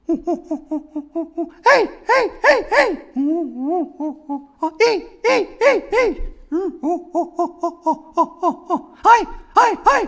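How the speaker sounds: very high chimp hoots; chimp vowels. Pretend. Chimpanzee practicing vowels, very high hoots. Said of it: "chimpanzee practicing his vowels".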